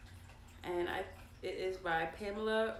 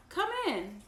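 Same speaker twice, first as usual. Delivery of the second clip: high, louder welcoming call; cheery welcome; 8 semitones higher, 5 dB louder than usual. A narrator's reading. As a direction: greet visitors brightly at the door. Mom welcomes visitors, read aloud.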